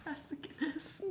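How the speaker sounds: high, hammed-up Irish accent. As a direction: toss it off like a stage Irishman. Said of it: "it's an accent".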